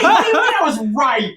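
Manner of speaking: high, laughing mock outrage; laughing disbelief. To the drunk guy who kissed him, laughing high outrage. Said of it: "And I was like".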